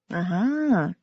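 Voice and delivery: plain reading voice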